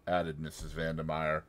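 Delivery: usual speaking voice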